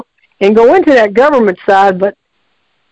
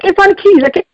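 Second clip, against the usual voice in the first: flustered excuse; 8 semitones higher, faster than usual. Retold or imitated. Kids in car excusing.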